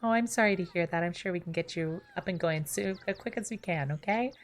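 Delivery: bright, chirpy phone-support voice; sweet, overly helpful call center agent; customer service voice. Retold or imitated. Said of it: "my fucking customer service voice".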